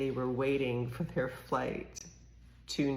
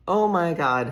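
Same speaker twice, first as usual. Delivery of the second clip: loud exclamation; 5 semitones higher, 12 dB louder than usual. Real, not acted. Reaction after laughing.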